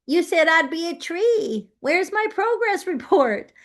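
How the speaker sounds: high, indignant little seed voice; mock-offended high voice, rising as it goes; impatient avocado seed. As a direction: whine it like a seed demanding its report card. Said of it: "imagine the avocado seed is thinking".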